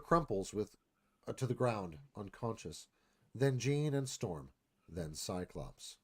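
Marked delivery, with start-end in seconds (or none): none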